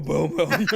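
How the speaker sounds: loud booms; mock hammer booms. Pinocchio smashing things, loud booms. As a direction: bang it out like hammering.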